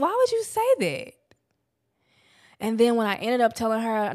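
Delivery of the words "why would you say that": much higher, incredulous question; shocked disbelief. Retold or imitated. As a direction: retell your own stunned reaction. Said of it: "And I'm like".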